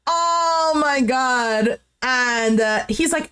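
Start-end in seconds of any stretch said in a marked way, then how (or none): 0.1-1.8 higher, slow drawn-out laughing exclamation; amused exclamation. Real, not acted. Host reacting to the retold argument, laughing exclamation. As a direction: draw it out through leftover laughter.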